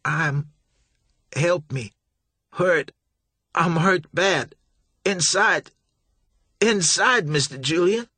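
high, strained, pain-racked begging; dying plea. A narrator's reading. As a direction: gasp out each word in agony. Dying Billy begs.

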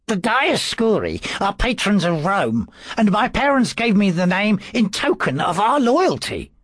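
high, eager, defensive priest; earnest priest voice. A narrator's reading. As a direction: insist on your loyalty. Priest explains his name.

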